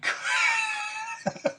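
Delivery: high, helpless laugh; burst of laughter. Real, not acted. Wrestling fan cracking up at his own question, high laugh.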